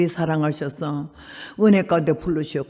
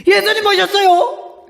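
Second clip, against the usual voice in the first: high, slow, laughing announcement; ecstatic announcement; 11 semitones higher, 5 dB louder than usual. Retold or imitated. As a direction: burst out with joyful awe. Waking from the rapture dream.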